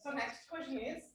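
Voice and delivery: faint and distant voice; almost inaudible, too far from the mic; barely audible. Real, not acted. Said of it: "Sir, your voice is not audible".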